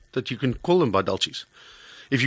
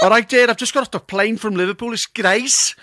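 higher-pitched put-on Scouse; Liverpool accent; 8 semitones higher, 5 dB louder than usual. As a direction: play the chirpy Liverpudlian pretender. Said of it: "I spoke like that".

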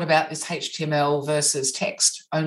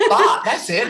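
bursts of laughter on the words; laughing; 8 semitones higher, 8 dB louder than usual. Real, not acted. Host laughing at the misheard word "bought". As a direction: laugh through it. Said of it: "both laugh at accent mixup".

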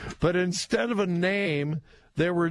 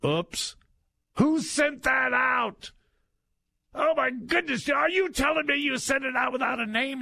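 high, flustered mock panic; mock flustered staffer; 8 semitones higher than usual. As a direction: play the embarrassed staffer caught out. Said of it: "after the extreme embarrassment".

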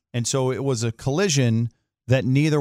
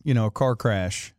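ordinary delivery

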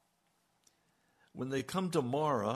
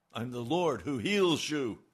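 proclaimed; 7 dB louder than usual. Shown as a demonstration. Repeats the promise with force.

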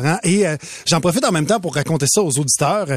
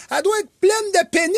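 put-on child shouting; 11 semitones higher, faster than usual. Daughter shouts about the mountain in a café. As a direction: yell it like a gleeful five-year-old. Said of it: "elle dit".

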